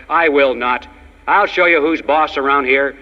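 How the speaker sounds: usual speaking voice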